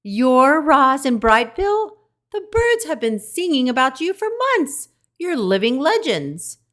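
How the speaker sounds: high, loud, thrilled little squeak; a starstruck skunk gushing; squeaking with excitement. A narrator's reading. Skunk meeting the famous robot, squeaking with excitement. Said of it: "the voice squeaked with excitement".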